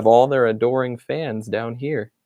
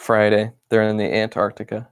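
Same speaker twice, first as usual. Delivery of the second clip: ordinary delivery, nothing marked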